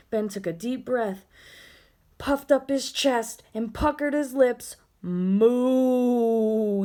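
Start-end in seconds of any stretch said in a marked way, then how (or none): 5.0-6.8 louder mooing bellow; a ghost bellowing a moo by mistake, louder. Acted. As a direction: bellow it like a cow. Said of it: "he bellowed".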